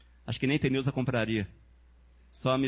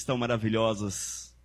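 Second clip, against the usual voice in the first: usual speaking voice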